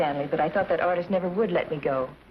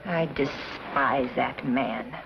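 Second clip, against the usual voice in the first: slow contempt; 5 semitones lower, much slower than usual. Acted. Woman to Stanley about the man who left, contemptuous. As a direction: let the disdain stretch out slowly. Declares loathing after man leaves.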